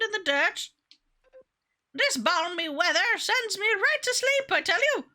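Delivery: put-on high, lilting old grandma voice. [name] greets a new face and chats, grandma voice. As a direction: play a gentle grandma, pitched well up. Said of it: "talking in grandma voice".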